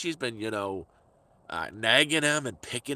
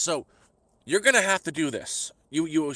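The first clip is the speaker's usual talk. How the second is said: mock bossy girlfriend, pitched up and louder; bossy order; 6 semitones higher, 5 dB louder than usual. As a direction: imitate her barking orders at you. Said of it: "she was ordering me to do something".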